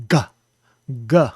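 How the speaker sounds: "g" explosivo, língua no palato; plosive g. Shown as a demonstration. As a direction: estoure o "g". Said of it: "letting the air come out in a plosive manner".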